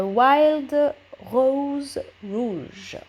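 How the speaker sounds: slow, careful English accent from a French speaker; attempted English accent. Real, not acted. Crafter names her color for viewers, slow attempted English accent. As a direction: spell out Wild Rose Rouge in a hesitant English accent. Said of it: "Excusez-moi pour mon accent".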